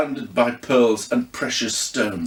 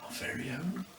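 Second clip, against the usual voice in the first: whispered, fading to nothing; awed whisper about the ghost; trailing off in awe; 8 semitones higher, 14 dB quieter, slower than usual. A narrator's reading. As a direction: breathe it out in awed wonder. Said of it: "She trailed off".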